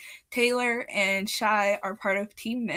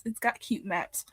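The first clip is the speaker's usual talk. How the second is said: normal talking voice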